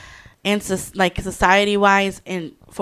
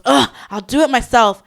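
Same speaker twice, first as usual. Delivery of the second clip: higher, louder exasperated groan, acted; fed-up wife; 5 semitones higher, 5 dB louder than usual. Wife to useless husband, acted exasperation. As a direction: give up on him in mock exasperation. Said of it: "they're fed up".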